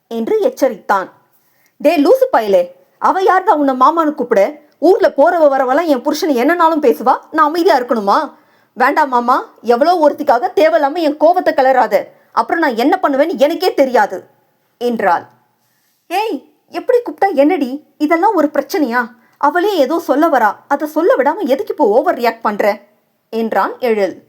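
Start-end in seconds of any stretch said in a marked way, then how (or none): 1.8-14.2 wife raging at husband over another woman; angry scolding. Acted. Jealous wife scolds her husband. 16.1-22.6 higher, fast exasperated scolding; husband rebuking his jealous wife; exasperated rebuke. Acted.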